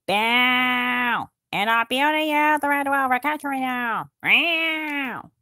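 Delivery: silly mock battle cry; play-shout the attack, half joking; playful battle cry.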